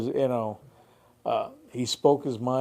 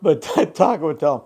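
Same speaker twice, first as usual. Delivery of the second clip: usual speaking voice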